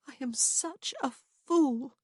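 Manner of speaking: high, slow, sorrowful self-reproach. A narrator's reading. Woman to her lover, sorrowfully blaming herself. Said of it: "she said sorrowfully".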